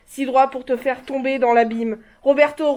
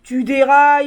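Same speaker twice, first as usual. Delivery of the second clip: much louder outburst at him; loud rebuke; 7 dB louder than usual. Acted. Mother shouting at her son that he is mad, loud. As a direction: burst out at him.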